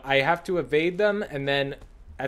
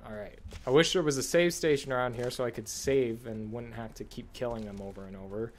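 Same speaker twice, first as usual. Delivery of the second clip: ordinary talk, nothing marked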